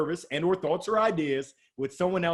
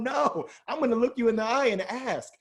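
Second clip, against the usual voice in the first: ordinary talk, nothing marked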